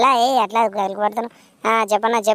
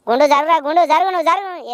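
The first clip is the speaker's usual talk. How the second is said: calling out; 6 semitones higher than usual. Real, not acted. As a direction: shout across the crowd to move. Calling out to bald man to move.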